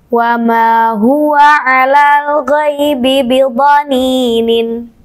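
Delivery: slow, drawn-out melodic recitation; Quran recitation. Shown as a demonstration. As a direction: chant it slowly like tilawah. (bacaan tartil yang sangat lambat dan berlagu)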